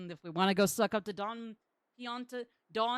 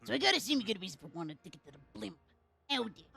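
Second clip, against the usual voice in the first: put-on Brooklyn accent; faster than usual. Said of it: "I can do a Brooklyn dialect".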